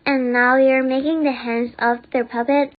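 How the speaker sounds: usual speaking voice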